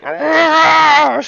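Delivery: imitating a guy's sudden pained scream at the urinal; short hammed-up howl; retold scream. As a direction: let out a high, loud mock yell. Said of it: "He just starts screaming".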